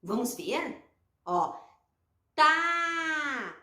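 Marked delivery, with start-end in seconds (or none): 2.3-3.5 Tá with a long held vowel; blended syllable demo. Shown as a demonstration. Teacher joining T and A, sounded out. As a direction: launch the T and sustain the á in Tá. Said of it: "será que eu vou ter um sonzinho".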